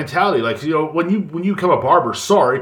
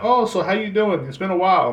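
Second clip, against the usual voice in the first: bright, raised chatty greeting, pitched up; high chirpy barber; 7 semitones higher than usual. Retold or imitated. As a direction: play the overly friendly hairdresser, pitched high. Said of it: "she's like".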